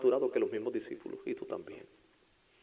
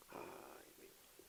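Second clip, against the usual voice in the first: whispered dismay; 26 dB quieter, much slower than usual. Acted.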